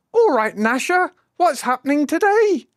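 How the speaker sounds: mock English accent. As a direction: mimic the posh English Dennis. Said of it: "doesn't sound like he's for Dundee".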